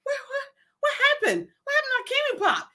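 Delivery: high, fast, laughing disbelief. Retold or imitated. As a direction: squeal it out in amused shock. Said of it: "I'm like".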